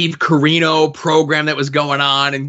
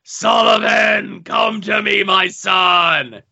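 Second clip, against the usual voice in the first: booming, drawn-out summons in a higher put-on voice; hammed-up theatrical villain calling; the Master; 4 semitones higher, slower than usual. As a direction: call out slowly like a master summoning his disciple. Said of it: "it's the Master calling to Kevin Sullivan, he's like".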